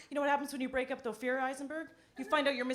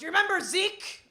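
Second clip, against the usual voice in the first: high, loud questioning voice; elderly mother quoted; 9 semitones higher, 6 dB louder than usual. Retold or imitated. Mother phoning her daughter about an ex, loud mock-mom voice. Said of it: "She goes".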